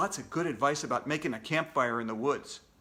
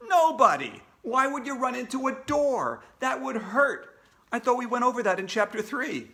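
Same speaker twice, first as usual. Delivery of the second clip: put-on higher, louder teasing kid voice; cheeky kid narrator; 7 semitones higher, 4 dB louder than usual. AJ teasing the class about running into doors, cheeky. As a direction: tease the listener like a smart-aleck kid.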